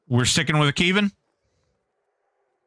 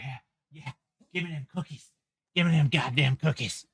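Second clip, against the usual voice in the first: greedy dog voice; 7 dB quieter, faster than usual. Pretend. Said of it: "He's like".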